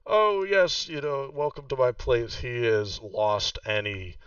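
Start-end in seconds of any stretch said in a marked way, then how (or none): 0.1-2.4 higher, smooth welcoming host voice; lightly voiced charming host, gracious and smooth; suave host. Sir Pox greeting guests before the death, lightly suave.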